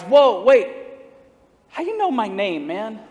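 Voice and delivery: sudden loud amazed outburst; surprised caller. Retold or imitated. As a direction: blurt it out, caught off guard. Caller amazed pastor knows name.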